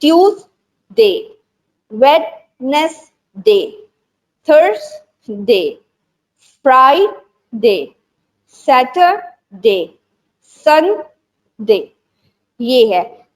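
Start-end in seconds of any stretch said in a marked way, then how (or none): 0.0-11.9 each day name said very slowly with pauses; slow demo reading. Shown as a demonstration. Teacher to trainee teachers, modelling weekday names very slowly. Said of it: "teacher demos days for class".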